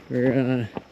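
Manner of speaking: ordinary delivery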